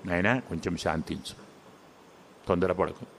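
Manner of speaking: soft, hushed calming of an upset friend; soothing Rama. Acted. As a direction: gently settle him down. Rama calms the angry Sugriva.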